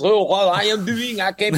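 high-pitched cartoon French accent; over-the-top French accent like a cartoon skunk; hammed-up French accent. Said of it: "Pepe Le Pew".

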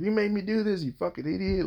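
mock drunken-fight rant. Man mocking his high-school self punching people, accusing. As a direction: send up your own fighting outburst in a high voice. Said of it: "punch people and be like".

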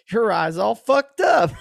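high, loud, slow taunting villain; mocking villain voice. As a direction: sneer it like the gleeful killer. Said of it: "And Negan's like".

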